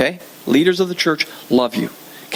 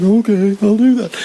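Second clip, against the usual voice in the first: sheepish compliance; 3 semitones higher, 4 dB louder than usual. Retold or imitated. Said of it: "I said".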